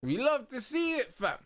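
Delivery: hyped shout; excited shout. Real, not acted. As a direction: belt it out in delight. Repeats line as a shout.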